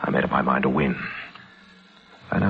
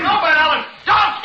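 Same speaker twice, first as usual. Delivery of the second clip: high, loud panicked cry; desperate scream; 6 dB louder than usual. Acted. Victor begging Van Allen not to shoot, panicked scream. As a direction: scream to stop him. Victor tries to stop the shot.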